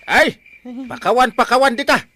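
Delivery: loud, high angry shouting; furious refusal. Acted. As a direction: throw the plea back at him. Uncle refuses forgiveness.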